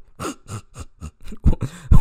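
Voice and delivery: breathy disbelieving laugh; incredulous laugh. Real, not acted. Laughing at her question.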